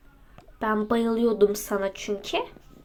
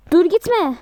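urgent plea; 7 semitones higher, 6 dB louder than usual. Acted. Urgently stopping someone leaving.

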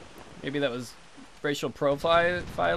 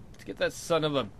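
ordinary delivery

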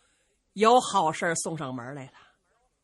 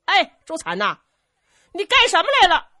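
soldier shouting down from the city wall, much higher and louder; calling down from wall; 9 semitones higher, 10 dB louder than usual. Acted. Soldier on the wall hails Zhu Can, loud call. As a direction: call out loud to someone far below. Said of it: "冲着朱惨就喊".

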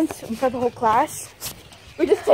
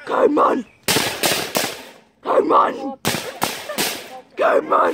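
caveman voice; 9 semitones higher than usual. Pretend. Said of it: "act like a caveman".

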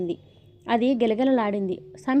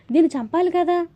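higher and louder, rushed determination; girl set on killing the snake, urgent raised cry; hurried and fierce; 7 semitones higher, 5 dB louder than usual. A narrator's reading. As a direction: blurt it out while hunting for a stick. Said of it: "అంది గబగబా కర్ర కోసం వెతుకుతూ".

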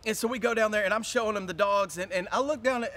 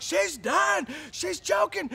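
panicked shout; 7 semitones higher, slower than usual. Retold or imitated. To the vet lady at the car, a high panicked cry. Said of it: "I'm like".

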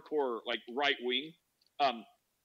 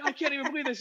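high, incredulous impersonation; mock indignation; 9 semitones higher, much faster than usual. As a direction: mimic a friend's appalled reaction. Retelling friend's outrage.

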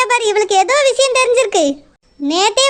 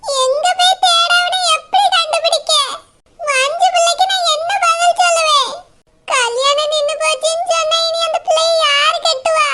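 put-on higher, tearful old woman lamenting; weeping grandmother; 6 semitones higher than usual. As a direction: wail over the family's shame. Grandmother despairing. (அவமானத்தால் அழுது புலம்பும் பாட்டி)

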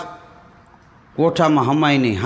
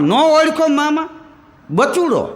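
put-on boy's voice; 9 semitones higher than usual. Boy greets the uncle.

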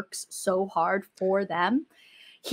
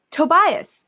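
raised, loud cry of the name; unreal mother's greeting; 8 semitones higher, 9 dB louder than usual. Acted. Mother greeting Tobias, half-committed loud call. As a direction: call it out high, not fully committed. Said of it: "that wasn't real".

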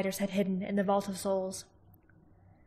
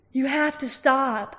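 Arya's plea; 5 semitones higher, 5 dB louder, slower than usual. A narrator's reading. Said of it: "said Arya".

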